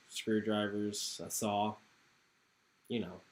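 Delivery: low, soft, hesitant plea; needy townsman. Acted. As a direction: ask humbly for help, voice sinking.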